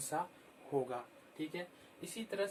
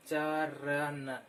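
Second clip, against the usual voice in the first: चरण drawn out very slowly and louder; very slow pronunciation; 4 dB louder, much slower than usual. Shown as a demonstration. Teacher stretching चरण so children hear each sound. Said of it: "बोलने से ही पता चल".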